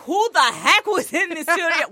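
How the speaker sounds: mock indignant surprise. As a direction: play someone baffled by what they found. Imagined reaction of Dave.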